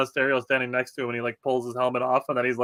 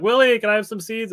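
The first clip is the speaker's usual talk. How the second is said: higher, louder call for attention; calling out to a player; 10 semitones higher, 5 dB louder than usual. Retold or imitated. Fan calling to Willy Adames for seeds, higher, louder call. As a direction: sing out over the dugout net. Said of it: "I'm like".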